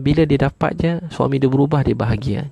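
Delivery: ordinary delivery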